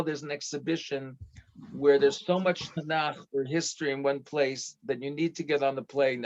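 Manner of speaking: normal talking voice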